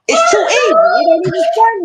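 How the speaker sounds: high, loud cry of it's too easy; shouting at the rival how easy it is; exasperated shout. Real, not acted. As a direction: blurt it out in exasperation. Repeats louder, exasperated.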